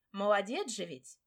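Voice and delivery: usual speaking voice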